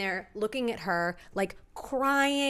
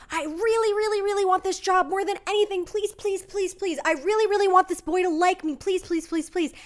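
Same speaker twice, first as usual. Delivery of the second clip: higher, fast whiny begging; desperate pleading; 9 semitones higher, 4 dB louder than usual. Retold or imitated. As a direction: beg like a child who wants it badly. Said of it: "you're like".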